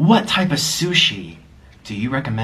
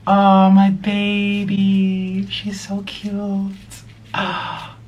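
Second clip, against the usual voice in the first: cooing at pet; 5 semitones higher, much slower than usual. Real, not acted. Fawning over his pet bird, slow cooing.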